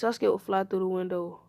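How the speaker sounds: usual speaking voice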